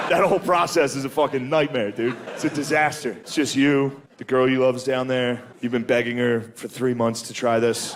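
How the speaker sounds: normal talking voice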